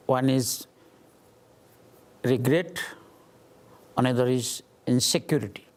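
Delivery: normal talking voice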